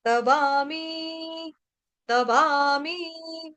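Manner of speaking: तवामी with both long vowels held, slowly; तवामी लंबी मात्राओं के साथ; long vowels drawn out. Shown as a demonstration. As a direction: stretch वा and मी in तवामी. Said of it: "दीर्घ मात्राएं दोनों".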